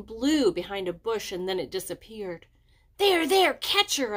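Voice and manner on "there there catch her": high, loud, urgent cry; scream. A narrator's reading. Girl spotting Denise, screams to the boys to catch her. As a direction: yell it as you spot her running. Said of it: "I screamed".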